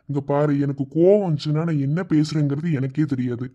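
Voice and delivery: normal talking voice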